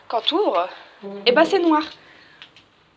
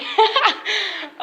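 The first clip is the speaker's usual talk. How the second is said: high burst of laughter; 8 semitones higher, 4 dB louder than usual. Real, not acted. After the door noise. (éclat de rire aigu)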